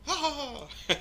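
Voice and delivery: high-pitched witch's laugh; witch cackle. Acted. Gamer showing viewers a witch flying around, witch cackle.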